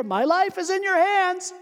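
high, slow grave call. Acted. Dangling stranger calls up a grave reminder. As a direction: call it up slowly.

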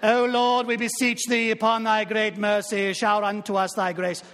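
mock-British chanted prayer on a level, sustained pitch; put-on British accent, solemn sing-song praying. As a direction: intone it like a pompous British clergyman. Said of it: "he went into a British accent".

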